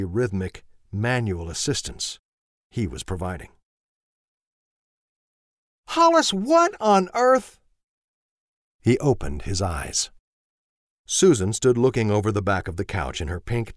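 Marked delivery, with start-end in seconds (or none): 5.9-7.6 high, loud, slow gasp of disbelief; appalled cry from the wife; shocked wife. Acted. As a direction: catch him in the act and shriek his name. Wife catches husband, shocked.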